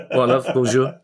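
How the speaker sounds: "love, bonjour" in a broad South London accent. Shown as a demonstration. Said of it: "if it's a South London accent".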